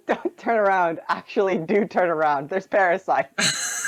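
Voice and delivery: giggling through the words; laughing, quoting the friend's game warning; laughing quote. Retold or imitated. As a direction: repeat the warning while laughing.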